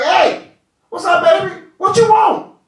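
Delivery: loud, high, swaggering king's greeting; a brash king hollering at his queen; booming king. Acted. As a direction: call out like a cocky king. King voiced in retold story.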